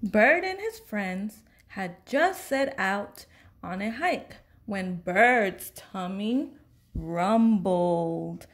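ordinary delivery

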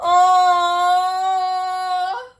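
sharp high gasp into a loud oh; real astonishment at the award; gasp of surprise. Real, not acted. Creator opening her award box, gasping in surprise.

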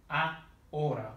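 "a, hora" in two separate pieces, no H; silent H. Shown as a demonstration. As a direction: say "a", pause, then "hora" with silent H. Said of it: "H in Spanish is never sounded".

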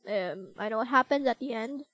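ordinary talk, nothing marked

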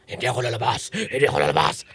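low, soft possessed voice. Acted. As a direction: growl back as the demon. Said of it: "satanas ka, demonyo".

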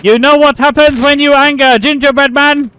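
high, loud mock fury; angry gingerbread man. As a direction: shout it as an outraged cartoon character. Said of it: "Very, very angry".

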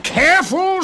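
very high, loud, outraged question; dwarf's shrieking retort; indignant screech. A narrator's reading. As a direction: screech the word back in disbelief. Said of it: "screeched the little man".

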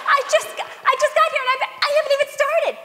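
high, rushed rambling like an auctioneer; fast frantic rant. Acted. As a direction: rattle it off breathlessly. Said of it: "You wanna slow it down".

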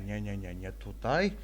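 ordinary talk

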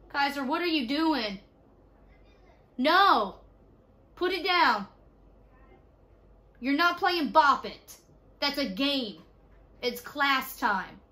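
loud, high-pitched telling-off; exasperated parental scolding. Real, not acted. Mother scolds child during class.

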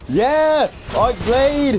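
high, loud victory yell; exultant gamer shouting; triumphant shout. Real, not acted. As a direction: whoop the kill out loud. Celebrates a kill in game.

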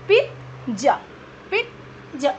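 पिज़्ज़ा in two beats with a "t", "peet - ja"; correct pronunciation. Shown as a demonstration. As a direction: stop on a "t" inside पिज़्ज़ा before "ja". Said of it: "still its pronunciation is". (पिज़्ज़ा को "पिट-जा" बोलें)

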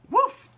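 short, high, loud imitated bark; dog woof. A narrator's reading. Ebb to busy Bird, high woof. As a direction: yap out a quick woof. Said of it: "said Ebb".

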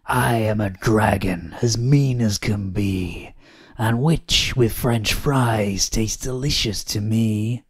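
slow, menacing dragon voice; mean dragon. A narrator's reading. Dragon threatening to eat the witch, slow menace. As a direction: draw it out like a hungry, menacing dragon.

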